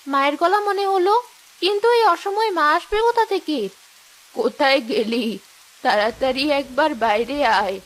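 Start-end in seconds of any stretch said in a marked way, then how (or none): 4.3-7.8 put-on low old woman's call; old mother. Old mother calls Rehana outside, low old voice. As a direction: call your daughter out like a grandmother.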